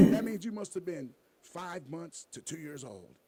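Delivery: mock legal-ad announcer; deadpan TV ad pitch. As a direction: deliver it like a lawyer commercial, pausing between phrases. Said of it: "I'm gonna do my T V voice".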